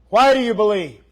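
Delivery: usual speaking voice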